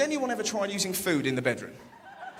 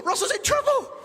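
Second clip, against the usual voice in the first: alarmed mum. Retold or imitated. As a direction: cry out like a startled meerkat. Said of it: "my mum's gonna go".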